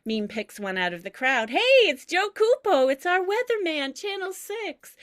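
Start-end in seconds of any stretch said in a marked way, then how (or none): 1.5-4.9 much higher and louder, delighted; thrilled to spot the weatherman; excited. Acted. Grandmother greeting the TV weatherman, much higher and louder, thrilled.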